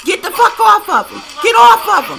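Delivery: high, loud screamed get off of him; woman screaming during a brawl; imitating Jaguar screaming. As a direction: scream it like you're breaking up a fight. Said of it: "you start hearing Jaguar say".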